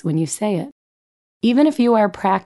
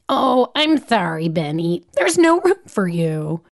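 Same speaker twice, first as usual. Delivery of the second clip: put-on gleeful child; 3 semitones higher than usual. Daughter gloating to her brother about no room. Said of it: "Birdie says gleefully".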